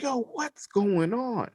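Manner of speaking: mock scream. Revived man screaming at the All Father, mock high-pitched yell. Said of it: "He was sitting there screaming at him".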